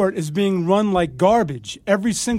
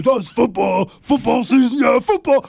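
higher, louder dopey fan voice, building; mocking the average fan; 4 semitones higher, 5 dB louder than usual. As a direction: lampoon a clueless football fan who gets louder. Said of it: "Then you got the average guy".

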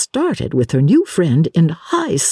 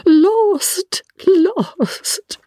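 very high, drawn-out cry of dismay; melodramatic wail. Acted. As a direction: wail it like a theatrical catastrophe. Miss Lavish laments being lost.

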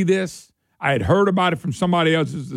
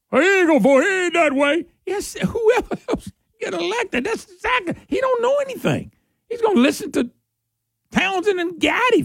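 high, sneering parody voice; mimicking a naive supporter defending the candidate, high and mocking; 13 semitones higher than usual. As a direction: ape the backers' excuses, pitched way up. Host mocks candidate's supporters.

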